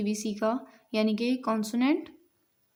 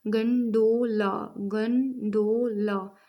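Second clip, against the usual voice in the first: "Gondola" drawn out slowly as गं, डो, ला; slow syllable-by-syllable "gondola"; split into syllables; much slower than usual. Shown as a demonstration. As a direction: say "gondola" in three separate beats, गं - डो - ला. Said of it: "इसमें जो sound को divide किया हमने".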